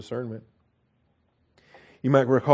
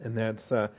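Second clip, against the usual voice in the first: ordinary delivery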